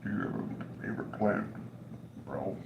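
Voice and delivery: soft, breathy whispered repeat; whispered mock question. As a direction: whisper it like a joke. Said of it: "computer's like".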